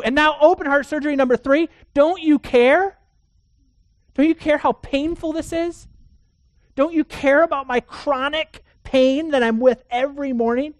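high, loud, pleading cry to God; desperate plea. Retold or imitated. Heart patient crying out to God, high loud desperate plea. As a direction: cry out to God in exasperated pain.

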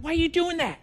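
high-pitched, acted-out indignation; angry protest. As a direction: complain upward like an aggrieved child. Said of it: "we get angry about it".

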